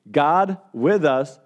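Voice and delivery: each word drawn out slowly; a slow, deliberate announcement of the title; slow title emphasis. Real, not acted. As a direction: give the series title weight, word by word. Announcing the sermon series title.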